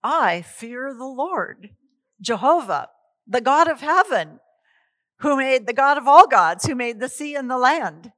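loud, proud, ringing proclamation; bold declaration. Acted. Jonah answers the sailors, proclaiming loudly and high. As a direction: declare your God with full conviction. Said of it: "He goes".

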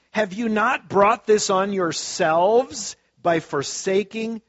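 loud, high prophetic reading; preaching scripture. Acted. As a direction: thunder the prophet's lament.

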